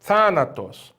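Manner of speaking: "Thanatos" said THA-na-tos, first syllable strong; stress on first syllable. Shown as a demonstration. Teacher answering which syllable is strong, "Thanatos" stressed on THA. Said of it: "qual é a sílaba forte".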